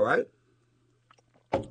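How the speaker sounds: ordinary delivery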